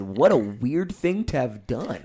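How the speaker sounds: slow, dry, unimpressed; slow deadpan. Retold or imitated. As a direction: stretch it out deadpan. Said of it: "I said".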